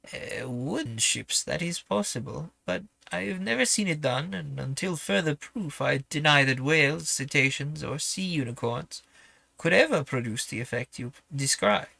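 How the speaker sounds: put-on sceptical whaler in a clumsy French accent; horrible French accent. Harpooner denies whales could pierce a steamer, horrible French accent. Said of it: "I'm just doing my horrible French accent".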